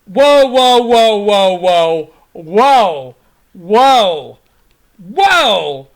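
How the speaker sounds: loud, drawn-out calls of whoa. Real, not acted. Calming the celebrating fans down, loud drawn-out whoas. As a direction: rein in the crowd with loud whoas.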